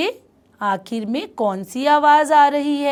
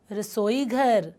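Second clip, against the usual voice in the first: normal talking voice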